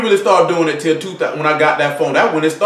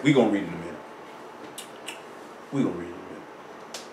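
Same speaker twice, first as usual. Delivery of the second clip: quiet aside; 11 semitones lower, 15 dB quieter, faster than usual. Acted. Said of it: "he sat back and said".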